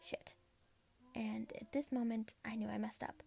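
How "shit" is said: whispered curse. Acted. Narrator realizes she messed up.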